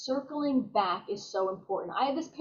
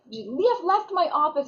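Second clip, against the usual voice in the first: angry patient; 5 semitones higher, 5 dB louder than usual. Retold or imitated. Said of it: "She's angry".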